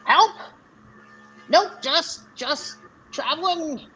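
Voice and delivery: high-pitched little goblin; high, drawn-out goblin reply. Acted. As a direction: answer as a goblin, pitched well up. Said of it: "and is like".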